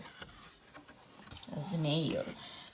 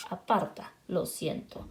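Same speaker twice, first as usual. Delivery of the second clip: plain reading voice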